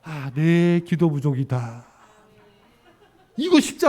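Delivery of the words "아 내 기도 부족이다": slow, low and humble; humble self-reflection. Retold or imitated. Pastor voicing a church member's humble reply, slow and low. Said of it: "박규현 성도님이".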